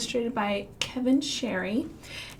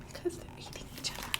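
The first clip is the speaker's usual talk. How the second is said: whisper; 14 dB quieter, much faster than usual. Acted. Aside about fish eating.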